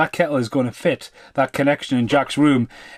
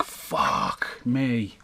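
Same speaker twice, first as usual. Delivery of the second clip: low, much softer, stunned "Fuck me"; imitating a dumbstruck friend under his breath; stunned mutter; 7 semitones lower, 9 dB quieter than usual. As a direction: drop it low like you can't believe your eyes. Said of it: "he's just like".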